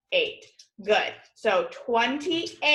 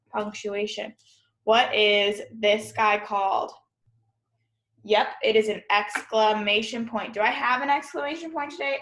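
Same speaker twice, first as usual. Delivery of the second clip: usual speaking voice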